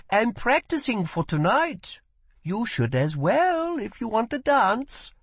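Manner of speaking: put-on a curious young woman asks; girl's voice. A narrator's reading. First girl speaks.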